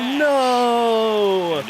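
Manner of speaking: high, loud cry of disappointment; a sharp wail as the ball falls short; crushed yell at a near home run; anguished no. Real, not acted. Ball dies at the wall.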